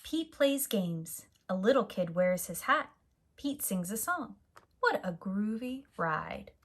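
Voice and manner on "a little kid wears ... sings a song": usual speaking voice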